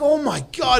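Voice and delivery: high shocked gasp of words; stunned disbelief. Retold or imitated. Discovering lump on arm.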